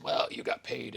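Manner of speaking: mock the know-it-all critic; lightly voiced scoffing critic. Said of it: "there's always a person".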